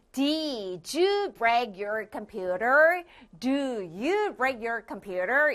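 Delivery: very slow, rising English question; slow model question. Shown as a demonstration. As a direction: draw out each word, rise at the end. Modelling question form slowly. (아주 천천히 올려서)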